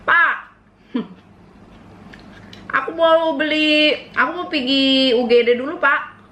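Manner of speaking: a hint of a nervous kid asking dad; lightly voiced sheepish child; asking father sheepishly. Kid telling father about going to the ER, lightly sheepish. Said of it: "kau ngomong sama bapak kau".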